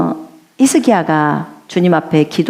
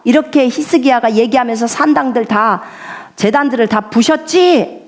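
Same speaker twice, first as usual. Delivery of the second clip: ordinary talk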